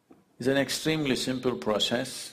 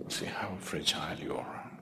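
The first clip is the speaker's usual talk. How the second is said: low, soft near-whisper; hushed; 6 semitones lower, 8 dB quieter than usual. Real, not acted. Teacher showing listeners how fragile life is, hushed. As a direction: drop to a hush to let the point sink in. Hushed point after breath stops.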